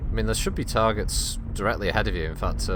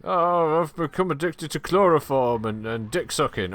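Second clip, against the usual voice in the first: high mock voice; 5 semitones higher than usual. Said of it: "I've got this, he been".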